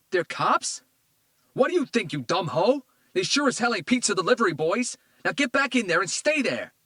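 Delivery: put-on man yelling at his girlfriend; furious yell. A narrator's reading. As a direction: scream it at her. Tito yells at woman.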